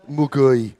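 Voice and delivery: muggy said with a Birmingham accent, a round Brummie u. Shown as a demonstration. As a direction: drop a Brummie "muggy" on the word. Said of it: "You can't say muggy without saying it in a Birmingham accent".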